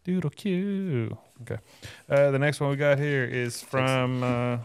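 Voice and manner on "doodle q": higher, very slow "Doodle Q"; "Doodle Q" held long and higher; playful drawn-out echo. Pretend. Playful echo of brand name.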